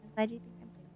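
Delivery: ordinary delivery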